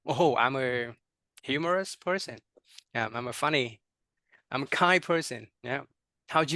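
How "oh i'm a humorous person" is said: ordinary delivery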